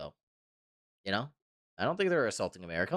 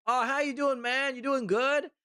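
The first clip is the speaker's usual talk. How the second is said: higher, cheery fake-casual hello; mock friendly greeting; 10 semitones higher, slower than usual. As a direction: greet a stranger like an old buddy. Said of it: "and be like".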